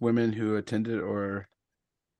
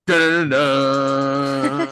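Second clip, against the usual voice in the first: loud, drawn-out "dun-dun" sting; mock-ominous musical flourish, held long; dramatic suspense sting; 3 semitones higher, 6 dB louder, much slower than usual. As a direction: sing out a slow horror-movie cue. Mock suspense sting after spooky remark.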